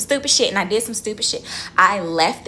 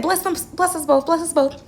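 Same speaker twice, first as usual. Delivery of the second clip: pleading blessing; 9 semitones higher than usual. Retold or imitated. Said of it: "cursing and crying".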